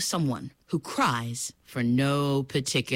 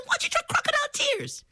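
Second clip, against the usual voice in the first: much higher, quick sly mother voice; crocodile mother; faster than usual. Acted. Mother slyly suggests crocodile tears, high and quick. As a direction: suggest it with a knowing lilt. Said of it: "She said".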